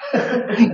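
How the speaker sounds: a brief soft chuckle; short laugh. Real, not acted. Preacher chuckling at the congregation. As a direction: laugh quietly at your own joke.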